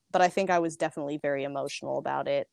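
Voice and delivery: ordinary talk, nothing marked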